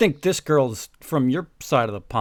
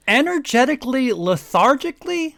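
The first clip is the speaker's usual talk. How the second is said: higher, disbelieving question; baffled by the words; incredulous; 10 semitones higher, 4 dB louder than usual. Real, not acted.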